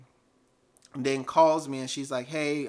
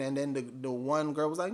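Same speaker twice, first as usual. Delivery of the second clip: plain reading voice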